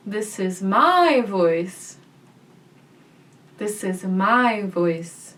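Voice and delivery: slow, with "my" drawn out and lifted; stress on my. Shown as a demonstration. Voice teacher demonstrating different emphasis, stress on "my". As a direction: stretch and punch the word "my". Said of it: "let's try emphasizing different words".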